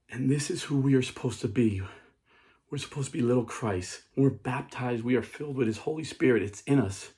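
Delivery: usual speaking voice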